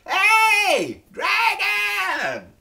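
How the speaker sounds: high, drawn-out, very loud holler; full-out shout. Shown as a demonstration. Teacher shows children the wake-up call, full shout. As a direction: yell it at the top of your voice. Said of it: "She shouted as loud as she could".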